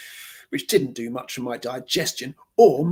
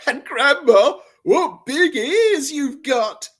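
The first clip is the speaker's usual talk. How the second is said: bratty taunt; 10 semitones higher, 8 dB louder, slower than usual. Acted. Brat taunting Grandma.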